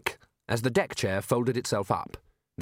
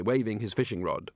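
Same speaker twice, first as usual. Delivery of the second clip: plain reading voice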